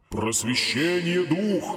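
seance medium. A narrator's reading. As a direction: call the spirit slowly and grandly. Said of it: "возвысил голос фантом".

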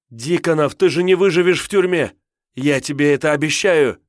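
high, loud angry shouting; furious threat. A narrator's reading. Handcuffed woman threatens her captor.

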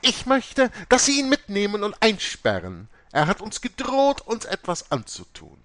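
high, loud, agitated demand; very upset. A narrator's reading. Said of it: "Mutter Oberst sagte ganz aufgebracht".

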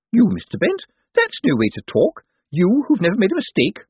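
a fussing carer covering her fear; nervous nurse. A narrator's reading. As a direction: Miss Drapes fussing over her patient, high and jittery. Nurse reassuring.

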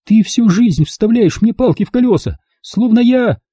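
high, bitter outburst; bitter tirade. Acted. Lauren confronts mother at the table, high and bitter. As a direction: pour it all out.

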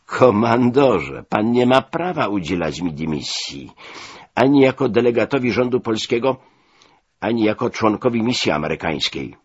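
ordinary delivery

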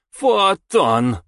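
high, strange creature voice; eerie cry. Acted. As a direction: cry it out like something inhuman. Boy names the sphere.

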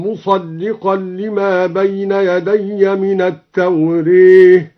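"التوراة" leaned toward tawreh, slow chanted; Quranic recitation with imala. Shown as a demonstration. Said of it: "أمال التوراة". (ترتيل بإمالة "التوراة")

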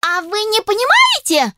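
very high, piercing squeal; shrill ultrasound. Acted. Elf shrieks at the inventor with a piercing voice. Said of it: "перешла эльфийка на ультразвук".

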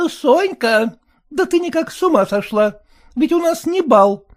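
high, sour, fretful wife voice; high complaining wife; sour merchant's wife. A narrator's reading. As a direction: whine it like a sour housewife. Mother voiced.

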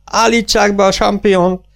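high-pitched commanding call; shouted orders. A narrator's reading. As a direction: call instructions to the stage crew. Calling orders across the theatre. (emelt hangú, kiáltott utasítás)

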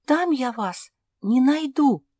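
ordinary delivery

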